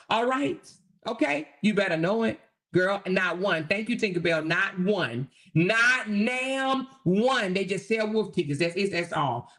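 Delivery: ordinary talk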